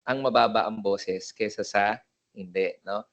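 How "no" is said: normal talking voice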